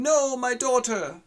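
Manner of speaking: high, loud, slow mock wail; wailing lament. Father wails over his dead daughter.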